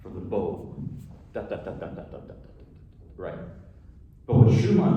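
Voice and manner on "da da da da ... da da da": quick soft staccato "da-da-da" syllables; imitated bowing notes. Shown as a demonstration. Lecturer demonstrating a study for the bow, soft staccato da-das. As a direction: quietly tick out detached violin bow strokes.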